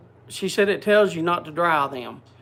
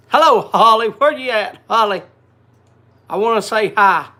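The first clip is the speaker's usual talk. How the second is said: loud, higher call to someone in another room; raised sing-song summons; 3 semitones higher, 6 dB louder than usual. Real, not acted. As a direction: call her in from the other room. Calling out to Holly.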